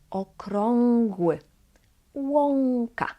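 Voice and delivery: okrągły, łąka with back-of-tongue ng; ą as ong. Shown as a demonstration. Teacher contrasts with earlier words, ą as ong. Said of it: "it's different from".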